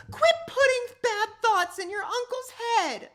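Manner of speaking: high loud scolding yell; angry shout. Acted. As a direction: mom shouting at her son, high and loud. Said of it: "Mom turned to me and shouted".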